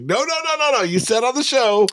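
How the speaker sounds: very high-pitched mock protesting; hammed-up high, insistent objection. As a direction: imitate someone objecting in a squeaky high voice. Acting out the protest in argument.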